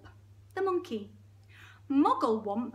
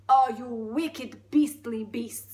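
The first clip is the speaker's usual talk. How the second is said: put-on slow, loud, outraged Russian accent; Russian elephant; 9 dB louder, much slower than usual. Elephant cursing the crocodile, outraged Russian. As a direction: denounce with heavy Russian emphasis. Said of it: "It's gone full Russian now".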